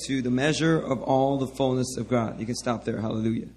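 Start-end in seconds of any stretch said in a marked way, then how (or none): none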